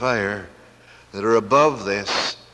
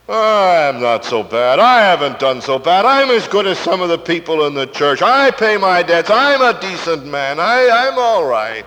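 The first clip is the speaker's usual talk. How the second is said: loud, high self-justifying boast; self-righteous protest; 6 semitones higher, 7 dB louder than usual. Acted. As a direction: protest your goodness at full preaching volume. Acted self-righteous excuses of the lost.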